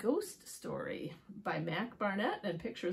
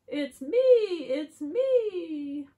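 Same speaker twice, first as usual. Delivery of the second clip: high, loud, stretched-out reveal; excited duck; 9 semitones higher, 12 dB louder, much slower than usual. Acted. Duck reveals itself under the mask, high excited cry. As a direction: crow it slowly as a proud duck. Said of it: "says the duck".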